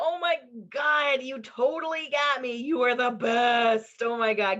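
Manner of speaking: high, loud, slow gushing; mock adoring. Greeting a scary spirit like a cute pet, loud gushing. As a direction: smother it with fake affection. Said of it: "approach them with love and go".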